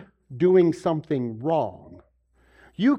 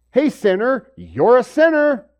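higher, louder, slow accusing call of sinner; mock accusing shout; 7 semitones higher, 9 dB louder, slower than usual. Preacher mocks people who condemn with a loud accusing shout. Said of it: "come down and say".

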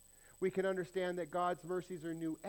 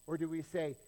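ordinary delivery

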